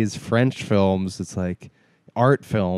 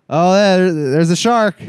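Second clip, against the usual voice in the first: raised, loud mock announcement; mock alarm; 5 semitones higher, 5 dB louder than usual. Mocking film characters spotting the shark, mock alarm. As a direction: exaggerate spotting the obvious.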